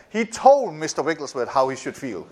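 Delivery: normal talking voice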